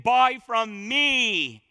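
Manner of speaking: "buy from me", very slow, heavy on "me"; specific, emphatic ME. Shown as a demonstration. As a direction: stretch "buy" and "me" out firmly. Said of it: "It's specific".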